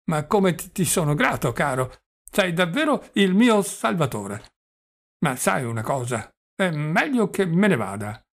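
put-on doddering old prince.

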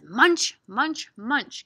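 loud, punchy munching chant; cow's munching. Acted. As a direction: punch out each munch for children. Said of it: "help me say what cow says".

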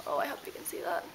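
low, hushed whisper; whispered aside. Real, not acted. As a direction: murmur it as a quick whisper. Aside while showing lipstick.

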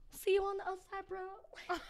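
high, soft little goodbye; mock sorry farewell. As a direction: send off a brother left behind, hushed and high. Retold farewell to failed brother.

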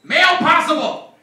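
loud, high, slow guard's call; security guard yelling out at the checkpoint; yelled announcement. Retold or imitated. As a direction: bark it across the checkpoint. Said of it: "yells out".

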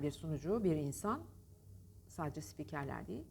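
ordinary talk

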